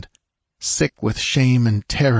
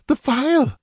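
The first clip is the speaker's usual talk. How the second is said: much higher, desperate woman's voice; gasping urgency. A narrator's reading. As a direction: gasp it out in urgent need. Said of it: "she gasped".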